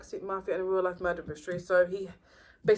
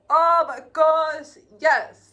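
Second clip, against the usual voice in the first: loud, higher, slow drawn-out delight; thrilled; 7 semitones higher, 10 dB louder, much slower than usual. Real, not acted. Fan thrilled by the shield, slow loud delight. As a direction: gush over the shield like a fan.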